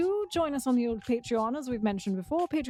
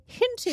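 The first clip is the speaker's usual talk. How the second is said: hinted said high and loud; stress on hinted; 11 semitones higher, 4 dB louder than usual. Pretend. Host teasing the rumour, hinted said high and loud. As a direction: lift hinted up high and lean on it. Repeating word for emphasis.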